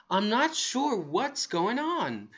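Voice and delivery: acted bewilderment; confused. Shown as a demonstration. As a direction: draw it out in a lilting, unsure way. Models a confused expression.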